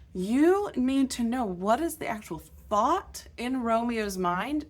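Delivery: ordinary talk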